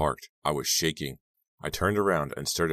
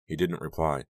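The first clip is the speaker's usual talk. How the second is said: ordinary delivery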